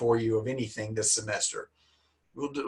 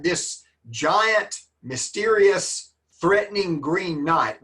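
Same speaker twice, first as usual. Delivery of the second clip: slow ominous build; 4 semitones higher, slower than usual. Acted. Building up the Green Knight.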